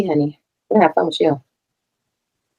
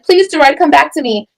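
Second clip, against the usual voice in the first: mock the pleading woman she was accused of being; mock begging; 6 semitones higher than usual. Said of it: "I wasn't like".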